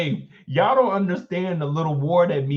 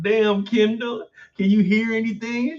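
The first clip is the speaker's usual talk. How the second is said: imitating someone calling to a muffled friend; mock calling out; 7 semitones higher than usual. Classmate teasing Kendall about the popped collar, mock calling out.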